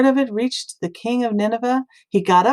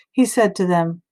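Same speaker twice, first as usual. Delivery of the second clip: plain reading voice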